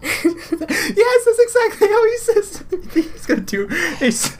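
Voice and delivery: high squeal through helpless laughter; words breaking up into high-pitched laughter; laughing hard. Real, not acted. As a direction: try to talk while cracking up. Cracking up at the accent imitation.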